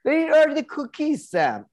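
high mocking whine; mocking coworker. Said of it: "he would even be sort of a, like".

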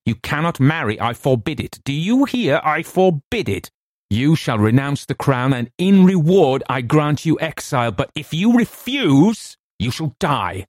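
high, imperious forbidding; shouted command. A narrator's reading. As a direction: forbid it outright, voice climbing. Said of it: "I shouted".

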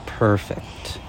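soft, quiet guiding voice; guided exercise. Acted. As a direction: lead them gently into listening. Leads a listening exercise.

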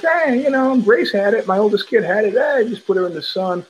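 high, eager offhand advice; eager reassurance. Retold or imitated. Father telling doctors to just put baby in sun, high and eager. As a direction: brush it off brightly, pitch way up.

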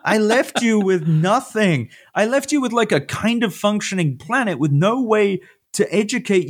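high, slowed, exasperated rant that softens into a tossed-off apology; a god scolding humanity for his own absence. Retold or imitated. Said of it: "being like".

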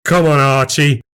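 confrontational. Acted. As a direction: get in his face. Confronting rival face to face.

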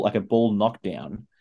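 usual speaking voice

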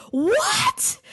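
high, shocked what; outraged what. Retold or imitated. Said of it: "You're like".

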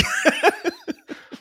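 high squealing laugh. Real, not acted. Mimicking Harlow's side nod.

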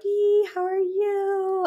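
put-on high, slow, drawn-out baby talk; parentese. Shown as a demonstration. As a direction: coo it like you're greeting a baby. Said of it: "It's higher pitched".

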